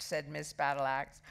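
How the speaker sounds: usual speaking voice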